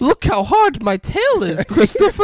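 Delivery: flirt high like a silly bear; mock Pooh. Pooh showing Christopher his tail, high cooing voice. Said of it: "acting as Pooh".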